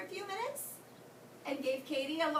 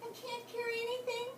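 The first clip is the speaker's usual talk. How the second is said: tearful; 7 semitones higher than usual. Acted. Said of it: "a great big tear".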